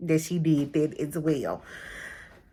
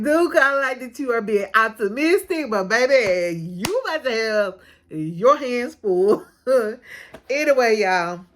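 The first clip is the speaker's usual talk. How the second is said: ordinary delivery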